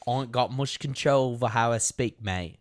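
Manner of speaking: mangled mock British-Australian excuse; bad British-Australian accent. Excusing own voice to co-host, bad British-Australian accent. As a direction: plead helplessness in a badly faked British accent. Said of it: "England moved to Australia and then had their voice box replaced with a blender".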